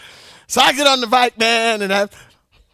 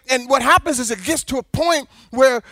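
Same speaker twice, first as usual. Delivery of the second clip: ordinary talk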